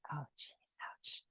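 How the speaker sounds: soft breathy whisper under her breath; whispered ouch. Real, not acted. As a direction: wince quietly to herself without stopping. Teacher reacts to a small mishap.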